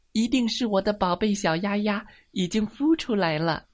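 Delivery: high, excited character voice; excited duck. Acted. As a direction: exclaim as a delighted duck parent. Duck guesses duckling hatched.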